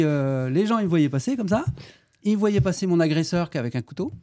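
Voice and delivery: usual speaking voice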